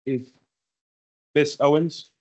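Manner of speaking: ordinary talk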